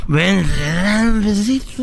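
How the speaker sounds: borracho imitado, lento y arrastrado; voz del vato. Retold or imitated. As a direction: pide el besito arrastrando las palabras. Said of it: "Y el vato así". (imitación de borracho coqueto)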